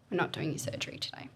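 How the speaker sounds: quick whisper; hushed bad news. Surgeon telling the patient surgery is off, quick whisper. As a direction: murmur the news fast under your breath.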